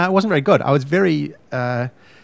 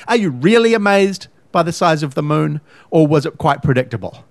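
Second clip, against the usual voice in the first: usual speaking voice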